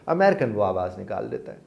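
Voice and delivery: ordinary talk, nothing marked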